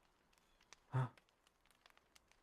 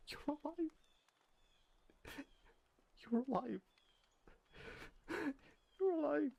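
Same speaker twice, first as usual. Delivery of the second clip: near tears of wonder, pitched way up; overjoyed. Acted. Overwhelmed to find the newcomer alive, high excited cries. Overwhelmed joy at finding them.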